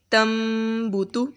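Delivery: تم بوتو with the نون turned to a nasal م; nasalised meem before the ب; nasal ghunna. Shown as a demonstration. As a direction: glide the م of تم through the nose into بوتو. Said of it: "اسے آپ تن بوتو نہیں پڑھیں گے".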